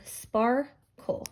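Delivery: "sparkle" as spar... kull, in two beats; split into syllables. Shown as a demonstration. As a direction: leave a gap before the kle of "sparkle". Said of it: "this is a two-syllable word".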